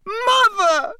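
very high, loud scream for his mother; a desperate boy begging his mother; screaming son. A narrator's reading. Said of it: "screamed the boy below".

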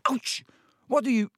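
high, startled yelp; pained yelp. A narrator's reading. Ron hit on the hand with shears, pained yelp. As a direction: yelp in pain, voice jumping up.

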